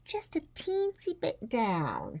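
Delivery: high, slow, half-whispered coaxing; coaxing father. A narrator's reading. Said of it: "said Ruby's father".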